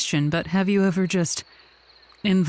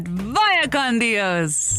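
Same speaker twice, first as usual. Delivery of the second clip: slow mock farewell; 2 semitones higher, much slower than usual.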